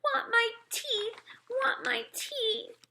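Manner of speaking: put-on high, slow whiny toddler wail; a little boy demanding his teeth back. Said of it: "Fudge started to scream".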